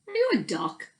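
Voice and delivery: high curious question; high possum's puzzled question. Acted. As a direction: Fuzz asking innocently. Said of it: "Fuzz asked".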